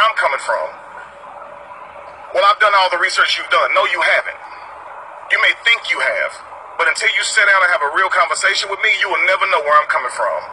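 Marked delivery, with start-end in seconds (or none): none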